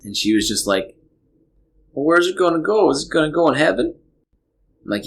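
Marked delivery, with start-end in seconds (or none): 1.9-4.0 put-on high, curious childlike voice; innocent little girl. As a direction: ask like a trusting four-year-old. Dad voices young daughter.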